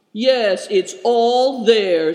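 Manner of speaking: loud, slow, grand assurance; lofty. A narrator's reading. Leader boasting about the map to his companion, loftily. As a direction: say it loftily, stretching the words out. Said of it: "said Good Fortune loftily".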